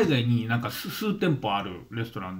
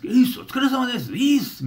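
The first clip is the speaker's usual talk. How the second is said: flashy guy imitation; 12 semitones higher, 3 dB louder than usual. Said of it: "みたいな".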